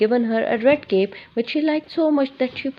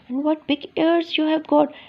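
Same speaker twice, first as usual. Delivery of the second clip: nervous; 6 semitones higher than usual. A narrator's reading.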